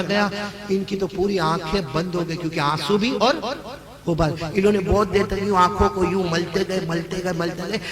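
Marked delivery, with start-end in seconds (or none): none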